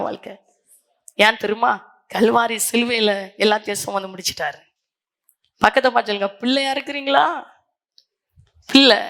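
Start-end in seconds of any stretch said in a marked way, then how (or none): none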